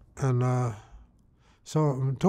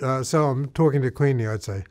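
ordinary delivery, nothing marked

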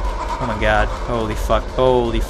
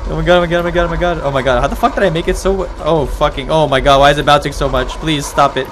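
fast, raised, frantic stream; panicked babble; 3 semitones higher, 4 dB louder, faster than usual. Real, not acted. Player pleading for bouncing to stop, panicked. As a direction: let the words tumble out in alarm.

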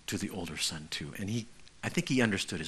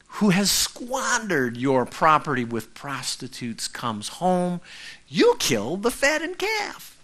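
put-on bitter older son; 6 semitones higher, 4 dB louder, slower than usual. Older brother's bitter complaint.

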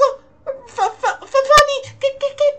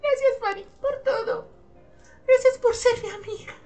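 tearful thanks; 4 dB louder than usual. Acted. Friend thanking Fanny at parting, tearful. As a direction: murmur it gratefully, pitched up.

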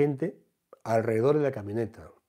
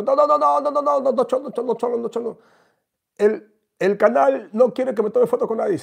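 very high, loud panicked no's; shrill refusal; 11 semitones higher, 9 dB louder than usual. Retold or imitated. Celebrity refusing the teller's photo, squealing high and loud.

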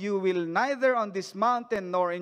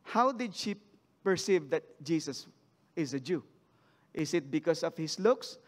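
ordinary talk

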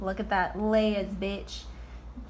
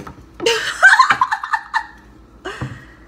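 loud high burst of laughter; 8 dB louder than usual. Real, not acted. Laughing at own joke.

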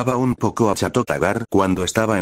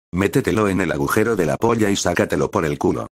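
ordinary delivery, nothing marked

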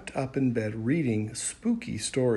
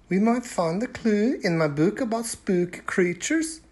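put-on higher girl's voice, eager; a girl with an idea; Ebba's voice; 9 semitones higher than usual. A narrator's reading. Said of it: "Ebba said".

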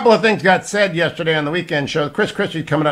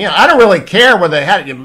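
fast, louder, higher mock yell of a heckler; retold yell; 6 semitones higher, 9 dB louder, faster than usual. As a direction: bark it back the way the caller yelled. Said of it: "this fellow comes up and yells at me".

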